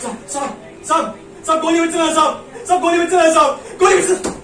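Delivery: high, loud, desperate begging; terrified pleading. Acted. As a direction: plead frantically for your life. Begging not to be shot.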